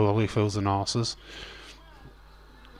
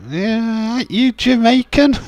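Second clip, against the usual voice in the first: much higher and louder mock Triple H jab; 13 semitones higher, 8 dB louder, slower than usual. Streamer retells Triple H calling out the fake accent, high and loud. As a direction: squeal it out like a taunting wrestler. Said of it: "It was like".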